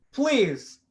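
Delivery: ordinary delivery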